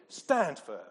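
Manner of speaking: loud, firm order to stand; Paul ordering the church to stand, loud and firm; firm command. Retold or imitated. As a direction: plant your feet and command it. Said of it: "Doesn't even sound like they're attacking".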